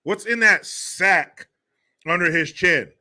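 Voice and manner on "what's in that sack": ordinary talk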